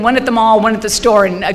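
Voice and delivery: ordinary delivery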